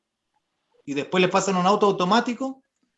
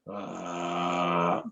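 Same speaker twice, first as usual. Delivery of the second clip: low creaky fry on a held ah; vocal fry; 12 semitones lower, 9 dB quieter than usual. Shown as a demonstration. Voice teacher showing the class vocal fry. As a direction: let the voice crackle at the very bottom. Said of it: "Esa es la voz frita".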